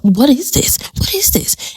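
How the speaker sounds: high breathy whisper, rising in disbelief; Lydia's stunned whispered question; confused sister. Retold or imitated. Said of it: "Lydia is like".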